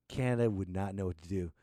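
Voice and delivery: usual speaking voice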